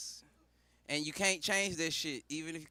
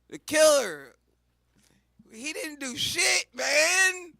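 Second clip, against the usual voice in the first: crowd shouting; 9 semitones higher, 10 dB louder, slower than usual. Retold or imitated. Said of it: "Who y'all gonna release?".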